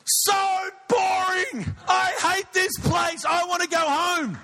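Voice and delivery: put-on high, slow, whining shout of a bored toddler; loud child's shout. Three-year-old son loudly complains in church: high whining shout. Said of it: "goes with a loud voice".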